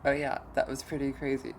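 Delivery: loud excited agreement. Acted. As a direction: burst out with it. Excited reply about broken vase.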